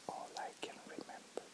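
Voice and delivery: soft, breathy fade; whispered. Real, not acted. The narrator trails off recalling the shelter night, whispered. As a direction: let the memory slip away in a whisper. Memory fades out.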